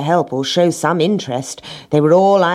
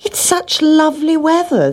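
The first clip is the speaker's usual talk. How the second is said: higher-pitched airy excuse; lazy animals' excuse; 14 semitones higher, 3 dB louder than usual. Acted. Cat and dog refusing hen's request, airy excuse. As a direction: airily beg off in a high voice.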